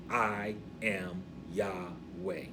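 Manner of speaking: low, soft and very slow; slow, gentle, weighty vow; tender declaration. Acted. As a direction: say it like a hushed "I love you". Same words meaning I love you.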